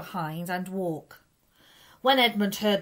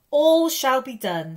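solemn promise; 5 semitones higher, 8 dB louder, slower than usual. A narrator's reading.

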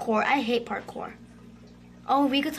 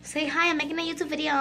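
rattled off in one breath; fast, casual request with no pauses; quick repeat; faster than usual. Real, not acted. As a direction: run the words together briskly.

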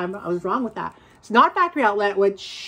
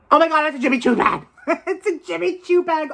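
rapid, laughing squeal of delight; real excitement, fast and laughing; excited; 6 semitones higher, faster than usual. Real, not acted. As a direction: gush it out fast, thrilled. Discovers a Jimmy Choo bag.

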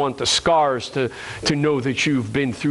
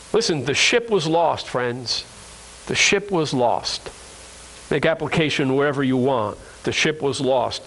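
ordinary talk

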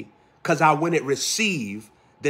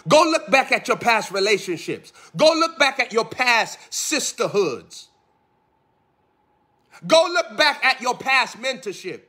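preaching at full force; 8 semitones higher than usual. Acted. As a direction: build the charge line by line.

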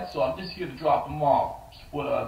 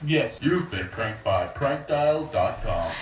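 slow, lower recorded reveal; slow announcer reveal; 4 semitones lower, much slower than usual. Acted. Prank site tells the brother he was pranked, slow announcer voice.

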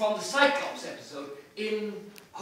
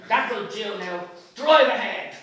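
louder, firm command; firm order; 2 semitones higher, 11 dB louder, slower than usual. Acted. Martin to the driver, firm loud order. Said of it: "says Martin".

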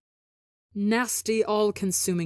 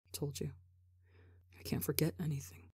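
softer whispered, almost breathless; 3 semitones lower, 13 dB quieter, faster than usual. Acted. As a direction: whisper it close to his ear. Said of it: "hears Ranbu whisper".

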